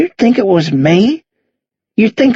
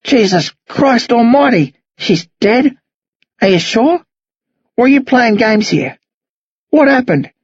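put-on higher-pitched, indignant old man; with feeling; 6 semitones higher than usual. Old man denying he killed the girl, indignant feeling. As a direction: protest the lie with rising, heartfelt urgency. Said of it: "Lionel says with feeling".

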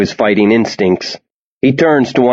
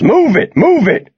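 barked commands, much higher; shouting orders. Acted. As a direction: yell it like a drill sergeant. Soldier herding kids into crates.

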